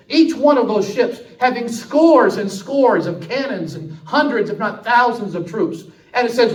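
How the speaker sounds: loud, high, driving sermon delivery; preaching at full force. Acted. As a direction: preach it hard and build the fire. Preacher retells battle at full force.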